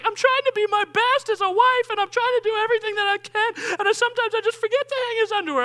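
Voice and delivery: high, fast put-on voice of a flustered wife; mimicking the wife. Wife excusing herself to her husband in a high put-on voice. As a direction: squeak it out like a harried wife. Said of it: "She's like".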